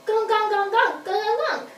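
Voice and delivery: high, honking puppet call. Pretend. Puppet asks the teacher a question, high and honking. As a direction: honk like a goofy puppet.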